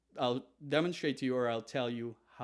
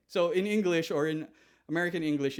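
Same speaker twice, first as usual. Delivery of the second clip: normal talking voice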